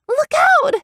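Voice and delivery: very high shouted warning; warning cry. A narrator's reading. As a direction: scream a warning. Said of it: "Evian cried".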